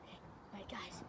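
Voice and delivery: barely voiced aside to viewers; whispering. Real, not acted. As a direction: breathe the words, no voice. Hushed to viewers while hiding.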